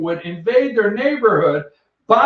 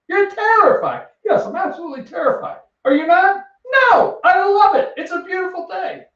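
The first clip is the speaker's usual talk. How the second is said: high, chirpy and delighted; the office optimist answering a gloomy colleague, high and cheerful; bubbly office coworker; 10 semitones higher than usual. Retold or imitated. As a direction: beam through every word like nothing is wrong. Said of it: "the bubbly one in the office".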